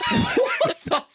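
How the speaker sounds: very high squealed disbelief, laughing; stunned disbelief. Retold or imitated. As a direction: squeal it in acted shock. Retold reaction to making the show.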